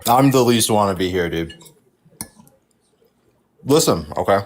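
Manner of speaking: chewing into the mic. Real, not acted. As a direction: talk while eating. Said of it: "Stop chewing into the fucking mic".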